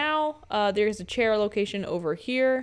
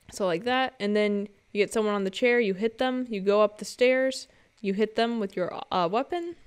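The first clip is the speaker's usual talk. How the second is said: ordinary talk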